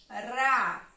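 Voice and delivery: teacher marking a syllable for the class. Shown as a demonstration. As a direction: sound out "Ra" as one beat. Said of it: "vou marcar a sílaba ao passo que eu vou pronunciando".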